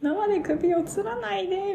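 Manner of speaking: high pleading plea in a put-on voice; pleading character. Fan imitating Rikaoni stopping the hanging, high plea. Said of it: "みたいな".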